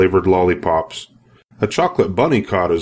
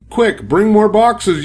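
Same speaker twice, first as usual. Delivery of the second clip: high urgent call; urgent worker's cry; yelled; 11 semitones higher, slower than usual. A narrator's reading. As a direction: call out for help in a hurry.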